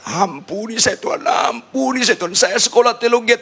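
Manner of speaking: high-pitched mock bawling prayer; childlike wailing. Pastor begging God's forgiveness for being foolish, wailing like a child. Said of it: "nangis meraung-raung kayak anak kecil".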